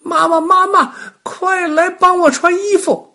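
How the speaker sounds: put-on high, loud child's yell for mum; shrieky little-kid cry for mother; urgent shouting. A narrator's reading. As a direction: holler for help as a frantic small boy. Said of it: "就急忙大叫了起来".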